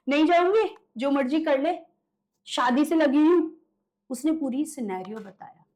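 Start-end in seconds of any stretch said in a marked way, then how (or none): 0.0-3.5 mocking laugh, then loud, higher defiance; laughing possessed woman. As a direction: imitate a possessed woman's laughing refusal, pitched up. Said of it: "पीछे से हंस के बोलती है". (हँसकर ऊँची आवाज़ में ज़िद)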